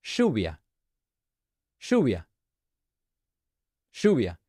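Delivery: lluvia said as SHOO-vee-a with a sh sound for the ll; Argentinian pronunciation. Shown as a demonstration. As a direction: Rioplatense lluvia, ll pronounced like English sh. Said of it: "I'm gonna say how we say rain in Argentina".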